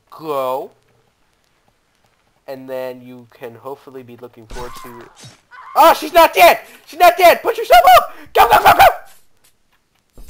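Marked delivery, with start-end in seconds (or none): 5.7-10.2 frantic high yelling until the voice cracks; voice cracking. Real, not acted. Gamer urges her on as she survives, voice-cracking yell. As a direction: yell very high and loud, voice breaking. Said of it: "My voice cracked".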